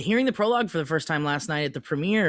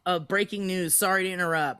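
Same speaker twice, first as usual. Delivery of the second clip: normal talking voice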